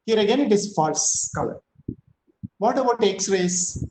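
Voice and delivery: ordinary talk, nothing marked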